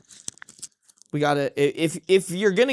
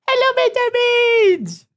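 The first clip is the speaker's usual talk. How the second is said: much higher, slow, louder sing-song hello; 5 dB louder than usual. Real, not acted. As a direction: squeal a drawn-out hello at the cat. Greeting the cat.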